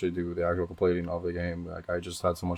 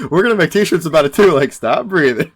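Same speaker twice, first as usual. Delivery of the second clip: high, loud laughing retelling; 9 semitones higher, 13 dB louder than usual. Real, not acted. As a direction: laugh through the words. Laughing, quoting the yell loudly.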